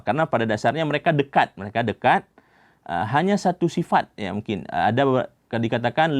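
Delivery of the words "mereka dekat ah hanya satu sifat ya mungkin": usual speaking voice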